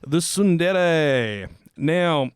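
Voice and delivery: slow, dramatic presenter build-up; show host introduction. Real, not acted. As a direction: introduce the topic with big, drawn-out emphasis. Presents the episode's topic.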